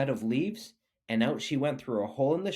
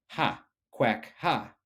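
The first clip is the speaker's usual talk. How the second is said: quacking laugh; much slower than usual. A narrator's reading. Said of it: "quacked the duck".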